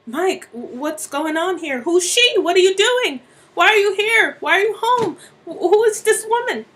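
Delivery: high, loud, stammering outrage; shocked confrontation. Acted. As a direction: fire off frantic accusing questions. Wife confronts cheating husband.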